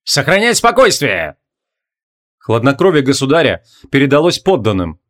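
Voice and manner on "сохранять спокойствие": slow, commanding king's voice; steady royal command; booming warrior king. A narrator's reading.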